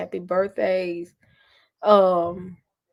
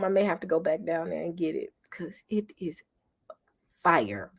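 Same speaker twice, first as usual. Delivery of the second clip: whispered craving; 5 dB quieter than usual. Real, not acted. Craving the food again.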